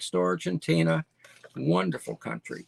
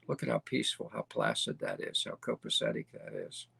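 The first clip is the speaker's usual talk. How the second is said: softer, low, breathy hush of awe; hushed admiration; 9 dB quieter than usual. Real, not acted. Showing viewers the peaceful downtown, hushed admiring tone. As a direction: let the voice sink to a reverent near-whisper. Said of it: "admiring the peaceful town view".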